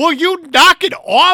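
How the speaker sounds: high, loud and slow, mock fed up; mock exasperated yell. Host telling the guest to knock it off. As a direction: drag it out like a fed-up parent.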